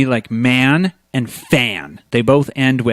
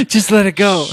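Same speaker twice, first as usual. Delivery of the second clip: laughing, high and light; 7 semitones higher than usual. Real, not acted. Telling a co-host to let the joke go, laughing. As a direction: giggle through it.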